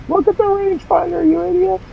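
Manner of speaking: high-pitched imagined heckler's yell; mock yelling. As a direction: pretend viewer yelling from afar. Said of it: "Somebody was probably yelling".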